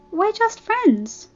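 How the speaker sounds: high and slow, drawn out; insist, too sweetly; insistent denial. Acted. Fluttershy insisting to Applejack, high slow denial. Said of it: "denying it".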